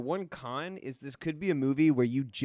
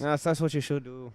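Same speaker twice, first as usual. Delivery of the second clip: lightly voiced Minnesota accent. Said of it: "you did a Minnesota accent".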